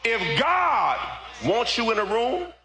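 high, loud, drawn-out sermon cry; full-force preaching. Real, not acted. Preacher rousing the congregation about God's favor, full-force preaching.

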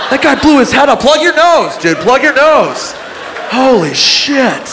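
high-pitched comic alarm; frantic bystander. Acted. Confused witnesses hunting for who farted, frantic. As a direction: warn everyone in hammed-up panic.

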